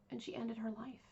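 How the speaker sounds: hushed, breathy whisper. Real, not acted. Telling viewers the daughter took her own life, whispered. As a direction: drop to a whisper for the grim fact. Somber true crime turn.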